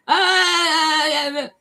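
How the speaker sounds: retold cry. To the helper on the phone, a sharp high cry of shock. Shouting at hearing bad news.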